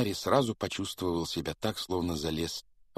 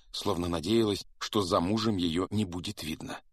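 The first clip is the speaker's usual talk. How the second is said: ordinary delivery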